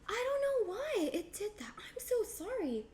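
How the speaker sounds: high, airy little apology; apologetic. Real, not acted. Streamer apologizes for the glitch.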